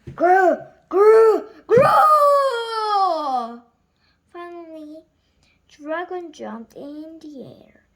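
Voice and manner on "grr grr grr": loud high shouted growls; loud growling. A narrator's reading. Monster growl in the story, shouted loud. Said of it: "got louder and louder".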